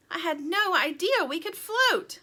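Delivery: high, loud delighted surprise; amazed. A narrator's reading. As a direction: exclaim at a happy discovery. Sweetie amazed after rescue.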